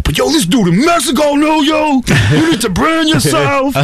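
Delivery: high-pitched shouted rant, hammed up; impassioned rant. Back from Mexico, urging Casey to brand himself, impassioned rant. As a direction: yell it like an overexcited hype friend. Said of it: "I went on a rant".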